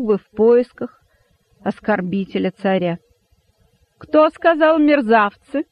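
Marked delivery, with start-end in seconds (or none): 4.0-5.6 high, loud, drawn-out demand; terrible menacing voice. A narrator's reading. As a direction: demand slowly who dared, voice raised. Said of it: "страшным голосом прошипел".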